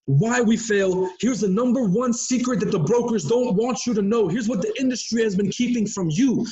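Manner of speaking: hyped, dramatic YouTube teaser voice; clickbait voice. Pretend. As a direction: sell it like a clickbait video. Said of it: "That's my clickbait voice".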